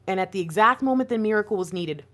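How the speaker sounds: ordinary delivery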